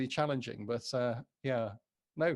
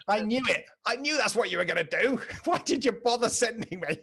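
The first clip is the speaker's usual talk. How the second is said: higher, louder indignant grumbling with laughs; mock grumble; 9 semitones higher, 4 dB louder than usual. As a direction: grumble like a sulky prophet, laughing. Voicing Jonah's grumble.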